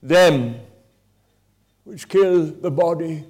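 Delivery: scripture quoted with force. Retold or imitated. Preacher quoting the Bible to a wounded attacker, loud then hushed and slow. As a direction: proclaim it, then let it fall to a slow hush. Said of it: "it says".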